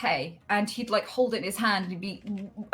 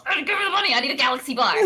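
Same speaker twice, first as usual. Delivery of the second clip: put-on much higher, louder, impatient childish pleading; greedy kid; 7 semitones higher, 6 dB louder, much faster than usual. Young self craving sweets, loud higher childish pleading. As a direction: whine for the money like a sweet-addicted kid.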